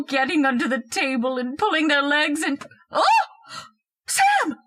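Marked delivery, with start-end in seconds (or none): 4.1-4.6 very high startled cry; sudden terrified call; shocked shriek. Acted. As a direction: scream her husband's name in fright. Wife sees ghost in chair.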